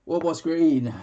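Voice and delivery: loud call across the room; a sudden loud shout-out. Real, not acted. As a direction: call it out to someone in the next room. Calls out to someone off mic.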